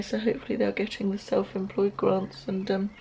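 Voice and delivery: usual speaking voice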